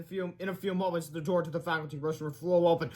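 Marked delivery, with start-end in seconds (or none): none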